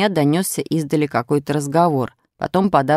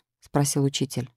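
ordinary delivery, nothing marked

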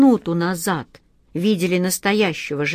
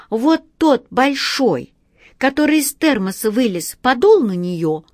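lightly voiced child, high at first; little girl's voice; 6 semitones higher, 3 dB louder than usual. A narrator's reading. Катя telling the boys about the genie, lightly childlike. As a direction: pipe it up like a child explaining. Child explaining the healed knee.

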